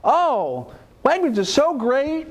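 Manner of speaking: high, slow, gushing praise in her voice; delighted customer. Retold or imitated. Speaker imitates the happy customer praising Wegmans, high, slow and gushing. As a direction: swoon over the store like a won-over shopper. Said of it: "customer's delighted reaction".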